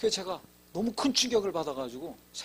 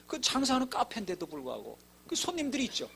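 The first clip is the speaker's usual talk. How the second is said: ordinary delivery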